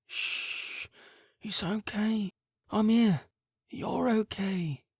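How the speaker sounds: soft high hush, slow and tender; soothing mother. A narrator's reading. Mother hushing her weeping son, soft and slow. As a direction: hush a crying child back to calm.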